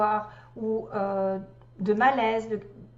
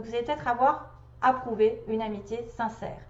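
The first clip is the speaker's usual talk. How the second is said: ordinary talk, nothing marked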